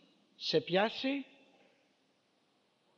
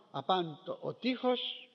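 normal talking voice

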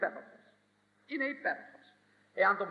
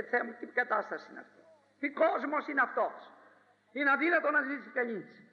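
higher, pleading cry to God; prophet's complaint; 5 semitones higher than usual. Acted. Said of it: "φώναζε ο προφήτης". (παραπονιάρικη κραυγή προφήτη)

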